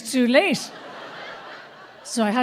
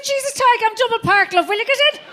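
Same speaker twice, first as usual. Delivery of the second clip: hurried urging; 10 semitones higher than usual. Retold or imitated. Double parked, urging child.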